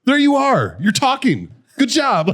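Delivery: very high, loud mocking cheer; mock congratulation. Customer congratulating the barista on talking, high mocking crow.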